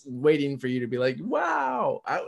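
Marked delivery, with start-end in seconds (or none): 1.2-2.0 a hammed-up impressed wow; mock amazed wow. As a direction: act hugely impressed. Expected impressed reaction.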